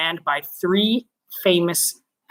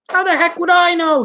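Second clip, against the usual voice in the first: exasperated; 12 semitones higher, 4 dB louder than usual. Acted. Ground control exasperated.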